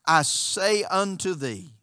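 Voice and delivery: slow, solemn scripture reading. Acted. Preacher reading scripture aloud to the congregation, solemn. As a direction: read the verse with reverence, slowly.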